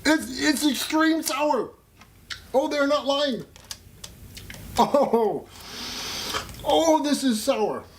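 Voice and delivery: high, loud puckered cries of shock; sour candy shock. Real, not acted. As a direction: yelp at the sour hit. Reacting to sour candy bite.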